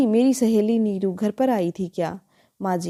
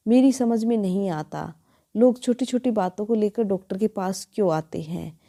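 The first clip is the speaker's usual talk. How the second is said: ordinary delivery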